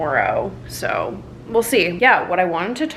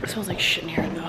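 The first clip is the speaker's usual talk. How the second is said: whispered aside; 3 semitones lower, 7 dB quieter, faster than usual. Real, not acted. Aside about smell.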